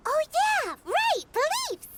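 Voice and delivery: remembering with a jolt; sudden recall. Real, not acted. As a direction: snap back as it comes to you.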